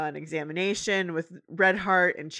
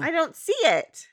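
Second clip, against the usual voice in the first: higher, louder fretting; Twilight's voice; 10 semitones higher, 5 dB louder than usual. Retold or imitated. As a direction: fret in a high voice.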